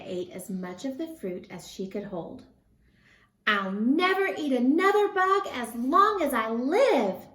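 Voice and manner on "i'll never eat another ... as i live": higher, louder happy cheer. A narrator's reading. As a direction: cheer with a full belly. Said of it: "cheered Stellaluna".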